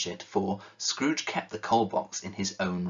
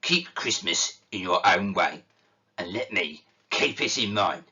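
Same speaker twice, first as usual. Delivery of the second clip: stern; 3 dB louder than usual. Acted. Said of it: "returned the uncle sternly".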